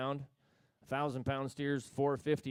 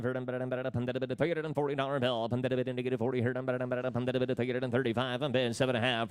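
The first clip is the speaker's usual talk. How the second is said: rapid rolling auction chant; auctioneer; 3 dB louder, much faster than usual. Acted. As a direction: rattle off bids in a fast singsong. Livestock auction bid calling.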